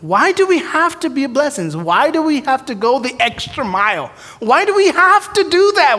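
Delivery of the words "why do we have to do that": preacher's pleading question. Real, not acted. Rhetorical question to congregation.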